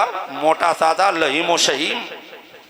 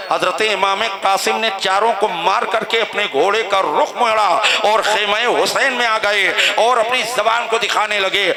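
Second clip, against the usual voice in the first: normal talking voice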